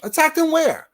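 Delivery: high, disbelieving "Attacked him where?"; incredulous question. Retold or imitated. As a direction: ask it in mock disbelief. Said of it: "Is what I asked her".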